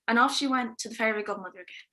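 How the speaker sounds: ordinary talk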